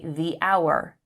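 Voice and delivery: THE as "thee" before hour, H silent; long THE. Shown as a demonstration. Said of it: "So we say".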